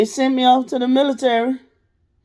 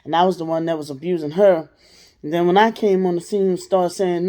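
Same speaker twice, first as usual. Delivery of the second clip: normal talking voice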